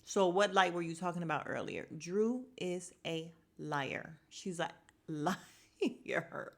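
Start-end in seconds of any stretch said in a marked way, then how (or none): 2.0-6.5 very slow, deliberate accusation; slow, amused, word-by-word pronouncement; drawn-out verdict. Real, not acted. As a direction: stretch out each word for emphasis. Emphatic verdict repeated after plain takes.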